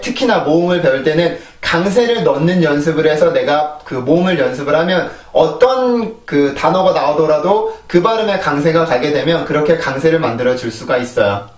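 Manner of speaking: ordinary talk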